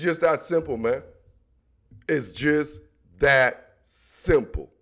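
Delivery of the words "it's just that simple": slow, lower, final-word delivery; slow emphatic repeat. Shown as a demonstration. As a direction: drag it out slowly, lower. Repeating the closing line for emphasis.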